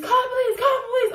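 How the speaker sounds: high-pitched, insistent imitation; urgent urging. Friend telling her to call the police, mock-urgent.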